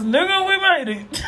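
triumphant. Retold or imitated. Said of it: "All I can say is".

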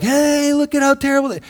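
high, whiny mock complaint; a snide, high-pitched gripe meant to shame; mock complainer. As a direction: play the petty griper pointing fingers. Mocking a petty complainer.

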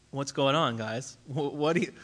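ordinary talk, nothing marked

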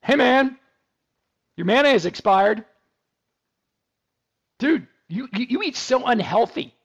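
high, loud nagging fridge voice; mock refrigerator telling him off; talking fridge. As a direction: play the hacked fridge scolding its owner. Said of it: "I wanna go hack a refrigerator".

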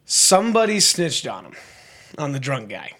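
ordinary talk, nothing marked

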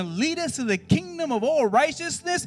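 high, loud, driving sermon delivery; full-force preaching. Real, not acted. Preacher warning youth about emotions, full-force preaching.

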